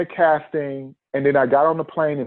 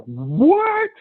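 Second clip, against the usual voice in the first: a high, stretched shocked "What?"; 14 semitones higher than usual. Retold or imitated. Hearing from the agency he got the job, high shocked disbelief. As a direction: sound stunned by the good news.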